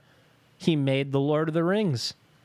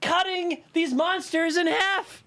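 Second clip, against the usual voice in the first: excited; 13 semitones higher than usual. Real, not acted. Excitedly recognizing a movie character.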